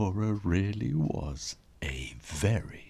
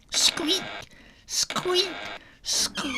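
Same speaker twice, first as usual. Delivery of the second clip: tiny very high squeaks; squeaky hinge; slower than usual. A narrator's reading. Imitating door hinges.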